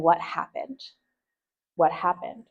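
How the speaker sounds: soft, caring "What happened?", rising at the end; gentle question. Shown as a demonstration. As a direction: ask "What happened?" with open concern. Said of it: "When we should be saying".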